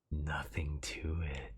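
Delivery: breathy creepy obsessed whisper; yandere voice. Acted. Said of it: "I'll try this in yandere".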